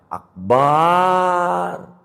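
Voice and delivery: Akbar with the ba held long; wrong long ba. Shown as a demonstration. Teacher repeating the wrong long ba. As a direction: stretch ba in Akbar. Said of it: "gara-gara ebaknya panjang".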